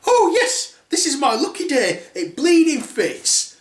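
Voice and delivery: high, loud gloating squeal; gleeful stepsister. Acted. Stepsister gloating the slipper fits, high and laughing. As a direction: crow it with a laugh. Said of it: "first stepsister's like".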